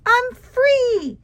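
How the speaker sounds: high, loud cry of freedom; gleeful yell. Acted. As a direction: burst out with delight. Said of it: "wiggled and giggled and yelled".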